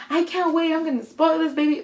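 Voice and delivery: high-pitched, eager gushing; thrilled anticipation. Retold or imitated. Dad buys first baby shoes, gushing with excitement. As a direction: imitate an excited grandpa-to-be.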